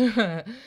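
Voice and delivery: laugh. A narrator's reading. Laughing at the joke.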